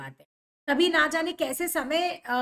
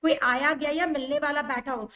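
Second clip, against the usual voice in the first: ordinary talk, nothing marked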